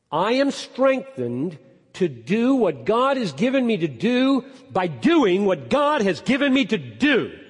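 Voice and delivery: loud, high, ringing declaration; full-force preaching. Real, not acted. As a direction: preach it out with emphatic swells on key words.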